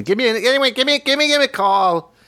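high, stammering impression; imitating friend's voice. Mimicking co-host to his face, high stammering impression. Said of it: "that's the way I see you".

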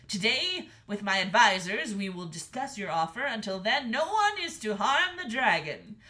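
loud, regal proclamation; proclaiming king. A narrator's reading. King ruling on the dragon's request, proclaiming loudly. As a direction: declare it grandly, at full voice.

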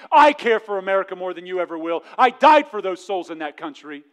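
booming, high voice of God scolding; God's voice. Acted. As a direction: thunder as God rebuking a proud boy. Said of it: "and God says".

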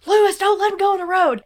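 high, loud mock alarm; urgent warning. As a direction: imitate calling out a panicked warning. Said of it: "he jumps up first, and he's like".

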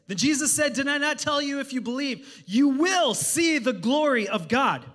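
fervent sermon reading; preaching at full force. Acted. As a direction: declare each word from the pulpit.

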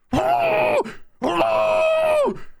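a wailing, high-pitched howl like the dog. Retold or imitated. Owner mimics dog left alone, wailing howl. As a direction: imitate the little dog screaming bloody murder. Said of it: "he screams bloody murder".

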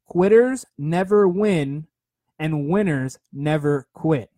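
each word spaced out slowly, like a creed; slow, deliberate motto. Shown as a demonstration. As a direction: drive it home word by word, slowly. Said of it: "Write that one down".

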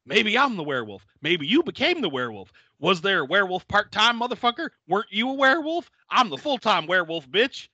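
hammed-up werewolf tirade; mock rant. Marcus flipping out at Finn, half-mock rant. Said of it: "Marcus then flips out".

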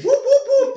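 high laughing whoop like an alarm; mock siren whoop. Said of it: "and he goes".

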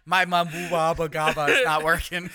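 giggling over a garbled made-up name, up and loud; laughing through nonsense. Real, not acted. Tongue-tied over the silly name, laughing. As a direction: laugh as the words fall apart.

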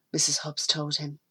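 ordinary delivery, nothing marked

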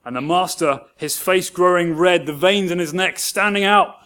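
ordinary talk, nothing marked

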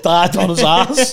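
slow, loud, drawn-out; slow laughing punchline. Real, not acted. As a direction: savour the punchline. Punchline of story.

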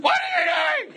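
very high-pitched, loud comic shriek; mock outraged yell. Preacher acting out a partner's wrong tone, mock outraged shriek. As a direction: burst out in hammed-up outrage.

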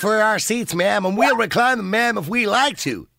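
slow, high-pitched mock retort; mock indignant passenger. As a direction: drag out each ma'am, pitched high, playing the affronted passenger. Host voices the other passenger's angry retort.

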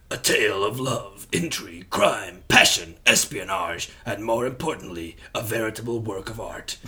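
portentous movie-trailer narrator; film trailer voice. Acted. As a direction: sell it like a dramatic cinema trailer. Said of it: "film trailer voice".

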